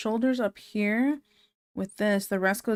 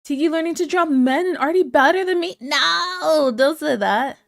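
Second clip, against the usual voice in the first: excited; 7 semitones higher, 6 dB louder than usual. Real, not acted. Reacting loudly to chat comment.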